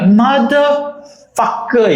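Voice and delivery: high, slow, drawn-out curse; inner curse. Retold or imitated. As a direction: silent fury let out. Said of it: "And I'm like".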